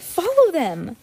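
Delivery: high, urgent order; exclaiming. A narrator's reading. Violet as the ruffians drive away, urgent cry. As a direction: call out high to give chase. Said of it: "exclaimed Violet".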